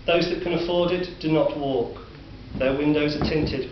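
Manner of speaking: usual speaking voice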